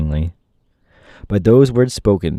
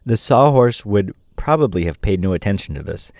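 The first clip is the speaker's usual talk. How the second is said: usual speaking voice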